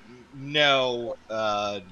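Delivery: usual speaking voice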